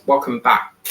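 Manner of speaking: "Welcome back" in a lightly imitated Swedish accent; a hint of a Swedish YouTuber's accent. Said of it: "He's from Sweden".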